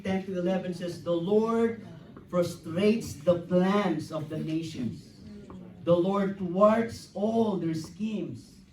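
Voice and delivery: fervent pulpit preaching, building. Real, not acted. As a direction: proclaim it to the congregation. Preaching at full force.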